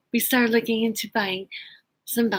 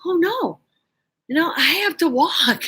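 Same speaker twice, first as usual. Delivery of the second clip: higher-pitched mock "oh no"; retold dismay; 7 semitones higher than usual. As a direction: exclaim in playful alarm. Retells her own dismay.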